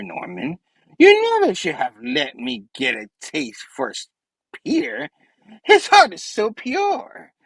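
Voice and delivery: sinister, theatrical villain taunting; Spidey Goblin. Acted. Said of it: "Spidey Goblin's like".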